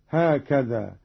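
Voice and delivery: usual speaking voice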